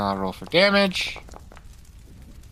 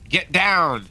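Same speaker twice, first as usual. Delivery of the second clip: raised, higher-pitched battle call; in-character shout; 7 semitones higher, 4 dB louder than usual. Pretend. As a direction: call out a quick warning in play. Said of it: "I'm gonna shout".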